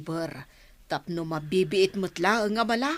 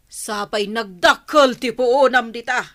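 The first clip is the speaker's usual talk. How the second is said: raised, loud voice of dismay; agitated and reproachful; upset protest; 4 semitones higher, 8 dB louder than usual. Acted. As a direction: protest the loss, loud and pitched up. Partners quarrel over lost capital.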